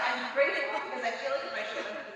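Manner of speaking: ordinary talk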